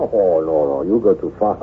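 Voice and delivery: normal talking voice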